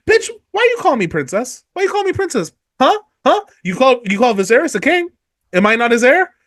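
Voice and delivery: high, sassy, indignant queen snapping back; sassy high retort. Retold or imitated. Dany snapping at Jorah for calling her princess, sassy and high. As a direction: toss the words at him, way up high.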